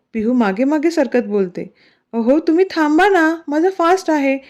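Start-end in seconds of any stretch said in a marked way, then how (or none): 2.1-4.4 exasperated plea, pitched higher; fed up, stop right there; narrated exasperation, higher pitch. A narrator's reading. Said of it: "पिहू वैतागून बोलते".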